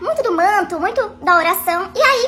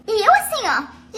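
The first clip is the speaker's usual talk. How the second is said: ordinary talk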